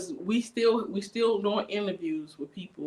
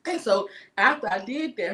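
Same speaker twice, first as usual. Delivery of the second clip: normal talking voice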